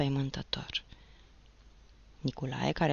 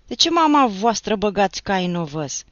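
gruff question; 4 semitones higher, 9 dB louder than usual. Acted. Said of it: "întrebă pândarul surd".

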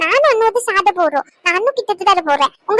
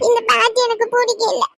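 tearful, higher whimper; crying child; 3 semitones higher than usual. Acted. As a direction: sob it out like a hurt kid. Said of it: "அழுகாதடி செல்லம்". (அழுதுகொண்டே குழந்தை பேசுவது)

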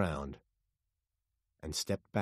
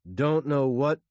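loud, higher report over radio; raised voice, short radio message; radio call; 6 semitones higher, 7 dB louder than usual. A narrator's reading. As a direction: call it through the noise. Heard over a noisy radio.

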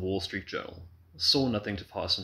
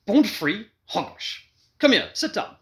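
loud, high commanding voice; lion tamer; 12 semitones higher, 8 dB louder than usual. A narrator's reading. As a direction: order her to sit like a lion tamer. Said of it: "he assumed his lion tamer's voice".

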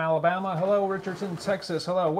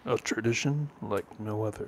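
Masters golf announcer; 5 semitones lower, 4 dB quieter than usual. Acted. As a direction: intone it like a Masters telecast. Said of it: "Do the master's line".

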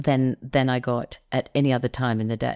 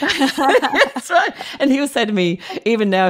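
laughing; 11 semitones higher, 7 dB louder than usual. Real, not acted. Laughing agreement.

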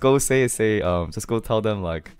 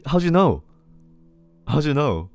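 high, louder startled question; mock astonishment; 7 semitones higher, 5 dB louder than usual. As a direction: act shocked at being found out. Reacts to being asked his name.

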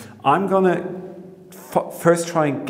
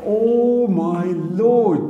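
slow awed exclaim; 6 semitones higher, 3 dB louder, much slower than usual. Real, not acted. Sees the dome inside.

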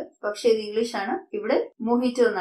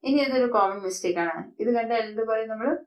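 normal talking voice

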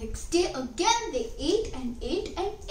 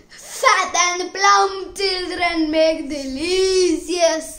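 slow, loud gloating witch; evil witch voice; 3 semitones higher, 8 dB louder, slower than usual. A narrator's reading. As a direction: savour each word, slowly. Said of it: "the witch was evil".

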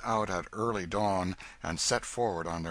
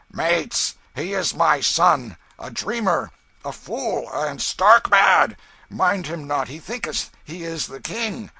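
loud, raised announcement to a rowdy crowd; shouting over din; 8 semitones higher, 10 dB louder, slower than usual. A narrator's reading. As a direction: make yourself heard over the uproar. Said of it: "attempts to make himself heard above the din".

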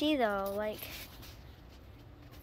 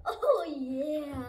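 ordinary delivery

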